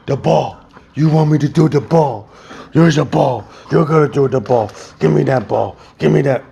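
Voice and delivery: playful, loud fetch teasing; excited dog talk. Real, not acted. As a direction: get the dog all worked up. Hyping up the dog.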